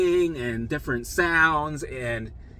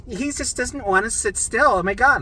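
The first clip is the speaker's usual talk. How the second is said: high, raised-voice mock complaint; exasperated staff; 8 semitones higher, 4 dB louder than usual. Nurse about a patient who won't sit still, exasperated. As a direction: complain loudly as the fed-up nurse.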